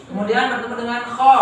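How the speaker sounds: normal talking voice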